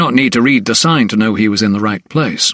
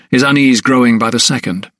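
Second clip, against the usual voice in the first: ordinary delivery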